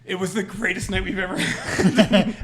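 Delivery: loud, high, drawn-out delight through laughter; laughing. Real, not acted. Storyteller recalling the night with his brother, laughing. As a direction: crack up while saying it.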